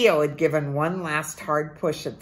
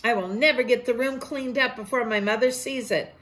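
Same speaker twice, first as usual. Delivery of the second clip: put-on higher, scolding child's voice; boy Ko scolding Tio, mildly angry; angry little boy; 8 semitones higher than usual. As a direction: order him like a cross kid.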